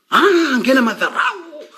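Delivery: a laugh breaking into a slow, high scoff. Real, not acted. Ranting about a TV show, he laughs in scorn. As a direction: laugh it off in disbelief at the insult.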